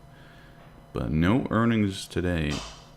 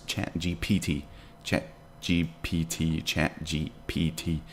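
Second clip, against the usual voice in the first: slow, separated letters; 3 dB quieter, slower than usual. Shown as a demonstration. Streamer testing the tricky name, slow and careful. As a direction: Chat G P T, each letter slow and distinct. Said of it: "But you can say Chat G P T super fast".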